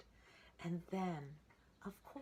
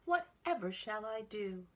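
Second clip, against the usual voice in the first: brother pig; 7 semitones higher, 8 dB louder than usual. Acted. Brother pig frets aloud.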